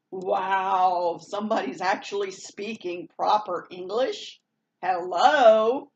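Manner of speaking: loud, drawn-out wow and hello; mock amazement. Vlogger acting out her reaction to proper English, mock amazement. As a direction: play up the astonishment, punching the last word.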